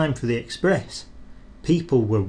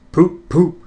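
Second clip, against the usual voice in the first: big engine horn; 5 semitones higher, 8 dB louder than usual. Acted. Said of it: "said Gordon".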